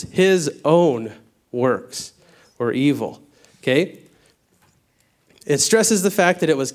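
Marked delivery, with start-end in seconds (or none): 0.0-3.2 "His own" drawn out very slowly with weight; slow down and hammer "His own"; stress on his own. Shown as a demonstration. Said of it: "That's the double emphasis".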